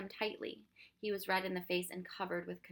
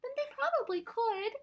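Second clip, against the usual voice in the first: put-on high, loud, upset child; wailing; 14 semitones higher, 6 dB louder than usual. A narrator's reading. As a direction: argue in a wailing, raised voice. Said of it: "she wailed".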